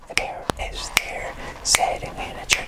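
"The bear is there sitting in a chair" breathed out unvoiced; whispered. Shown as a demonstration. Teacher varying the drill, whispering the sentence. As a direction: whisper the tongue twister. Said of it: "whisper heard".

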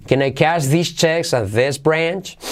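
accent "checks" and "branch", rise at the end; stress on checks, branch. Shown as a demonstration. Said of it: "Yo diría checks en branch".

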